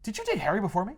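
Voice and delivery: high, lightly voiced jealous question; mock jealous Peter. Peter asking Mary Jane about Harry, high mock jealousy. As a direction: ask it like a sulky boyfriend.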